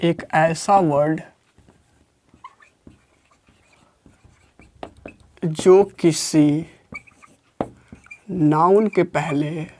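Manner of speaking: low, slow, word by word with long gaps; slow dictation. Shown as a demonstration. Teacher dictating the definition slowly for notes. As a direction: dictate so students can write it down.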